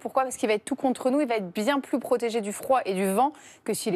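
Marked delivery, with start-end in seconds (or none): none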